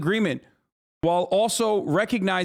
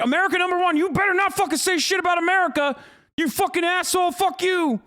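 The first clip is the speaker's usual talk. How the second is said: mock angry patriot; 10 semitones higher than usual. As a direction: yell it like a furious patriot mocking himself. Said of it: "You're like".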